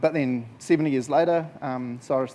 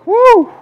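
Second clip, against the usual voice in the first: loud, high whoop; demonstrated woo; 16 dB louder than usual. Shown as a demonstration. Preacher shows congregation what to say to neighbour, loud whoop. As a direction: let out a big high woo. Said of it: "turn to someone and just go".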